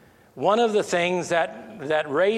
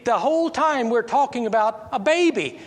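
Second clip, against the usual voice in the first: incredulous; 8 semitones higher, 3 dB louder than usual. Real, not acted. Incredulous rhetorical question to congregation.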